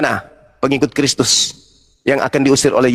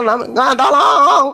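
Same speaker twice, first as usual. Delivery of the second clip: mock plea; 8 semitones higher, much slower than usual.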